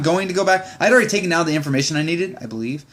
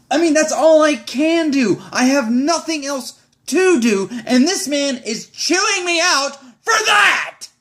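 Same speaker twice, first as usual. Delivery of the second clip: high, strained shout, slower; outraged cry; 8 semitones higher than usual. Real, not acted. Venting to viewers about being chewed out, strained shout. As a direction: cry out at the injustice, voice cracking. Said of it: "outraged venting".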